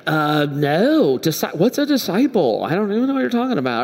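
acted dumb denial, pitch swooping up; feigned ignorance. Peter denies being a disciple, feigning cluelessness.

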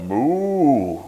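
a cow's moo. Acted. Boy turned cow trying to speak, a cow moo. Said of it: "was the only word that he could utter".

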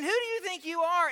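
high, mock-indignant challenge; frustrated. Preacher plays the crowd challenging Jesus, frustrated. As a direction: demand it as a fed-up heckler would. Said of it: "These guys get frustrated".

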